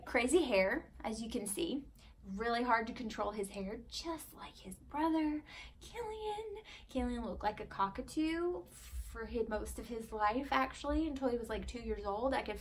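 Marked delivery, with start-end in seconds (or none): 3.9-8.6 high sing-song. Acted. High sing-song baby talk.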